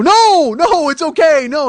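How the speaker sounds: loud, high panicked no; frantic high-pitched denial; panicked high yelps. Retold or imitated. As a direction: yelp it out in a flustered panic. Panicked refusal in retold scene.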